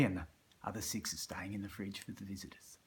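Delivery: hushed conspiratorial whisper; whispered promise to save beers for visitors; whispered aside. Real, not acted. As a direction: tell it like a secret.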